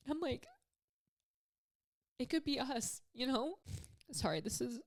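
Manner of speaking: real tearful halting speech; choked up and apologetic; crying. Real, not acted. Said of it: "I ended up crying".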